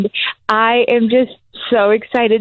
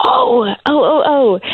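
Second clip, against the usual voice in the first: tearful, high and choked up; moved to tears; 5 semitones higher than usual. Real, not acted. New mother tearfully recalling the nurses' kindness, choked up. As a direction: overcome with emotion, voice catching.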